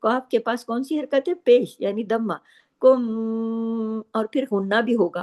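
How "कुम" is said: कुम with the मीम hummed long through the nose; held ghunna. Shown as a demonstration. Teacher holding कुम to show the doubled मीम ghunna. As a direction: hold the म of कुम as a nasal hum. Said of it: "आवाज़ देर तक नाक में छुपेगी".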